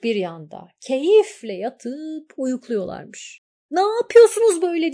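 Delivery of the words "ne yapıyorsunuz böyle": high, loud, fast scolding yell; angry shout. A narrator's reading. Girl wakes wolf and jackal, shouting high and fast. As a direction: snap at the sleeping guards. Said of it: "diye bağırmış genç kız".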